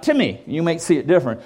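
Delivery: ordinary talk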